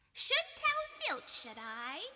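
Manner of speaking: high, lofty, mock-grand poltergeist voice; high sly faux-dignified tone; mock-stately Peeves. A narrator's reading. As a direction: play it pompous with a wicked glint. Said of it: "said Peeves in a stately voice".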